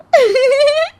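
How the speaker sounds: high sly snicker; gloating laugh. Acted. Daughter-in-law snickers at burned mother-in-law, gloating laugh. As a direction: snicker at her pain. Gloating after prank works.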